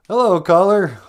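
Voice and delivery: host picking up the call; cheesy radio host. Acted. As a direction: greet the caller like a bored talk-show host.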